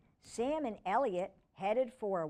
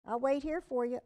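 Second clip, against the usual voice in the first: ordinary delivery, nothing marked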